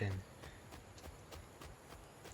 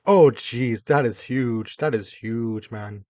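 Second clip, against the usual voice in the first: loud startled exclamation; shocked by its size; surprised; 10 dB louder, slower than usual. Real, not acted. Shocked at huge tree.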